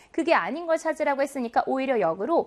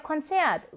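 Konzert with the stress on -zert; stress on second syllable; 3 semitones higher than usual. Shown as a demonstration. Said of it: "이음절 강세어이고요". (Konzert의 둘째 음절 zert에 강세)

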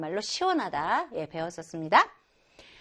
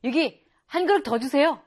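louder, pleased diner after hot soup; hearty satisfaction; 4 semitones higher, 4 dB louder than usual. Acted. A diner praising hot soup to the cook, pleased and louder. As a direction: savor the soup and call for more. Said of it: "막 이렇게".

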